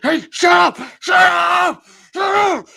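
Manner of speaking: loud, high shouted imitation of an angry mob; mad shouting. Angry crowd cutting off his explanation, mad shouting. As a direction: shout them down, each one a high yell. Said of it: "they're mad".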